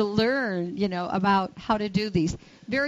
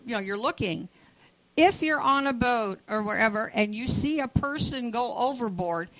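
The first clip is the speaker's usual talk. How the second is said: ordinary talk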